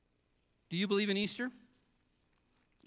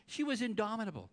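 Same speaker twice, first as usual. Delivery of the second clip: usual speaking voice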